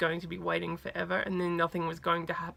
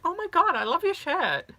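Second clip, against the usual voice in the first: high, loud excited compliment; delighted gushing; 11 semitones higher, 7 dB louder than usual. Retold or imitated. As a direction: imitate a star gushing over a fan's shirt. Idol's first delighted reaction, retold.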